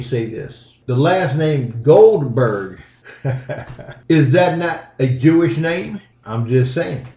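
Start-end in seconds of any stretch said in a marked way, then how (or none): 3.2-4.0 brief laugh at your own point; short chuckle. Real, not acted. Podcaster amused by the name Goldberg, short chuckle.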